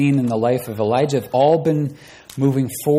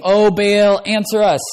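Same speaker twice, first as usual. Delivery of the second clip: high, louder cry to a silent god; pleading to Baal; 10 semitones higher, 7 dB louder than usual. Acted. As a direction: call up to the sky, begging.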